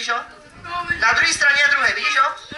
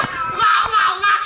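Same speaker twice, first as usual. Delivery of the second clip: magic wow; 9 semitones higher, much slower than usual. Shown as a demonstration. Magician shows the child what to shout, high excited wow. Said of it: "udělám tady to".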